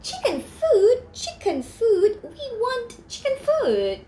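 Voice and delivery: high, whiny chanting chicken voices; high whiny demand from fussy chickens; chirping chickens. Acted. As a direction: squawk it like fussy hens.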